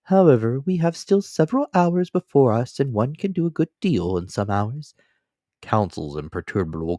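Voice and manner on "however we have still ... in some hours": higher, polite, devoted servant voice; a loyal manservant, calm and deferential; Counsel. Acted. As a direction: servant offering his shoulder to his master, politely.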